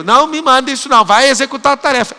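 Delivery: retold heated order; worked-up scolding. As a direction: get heated and order them to do the task. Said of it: "Eu ainda entro na pilha".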